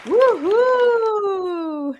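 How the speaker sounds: high, bright whoops of celebration; excited cheering. Real, not acted. Host cheering a viewer's celebration, excited whoops. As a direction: cheer them on with big woohoos.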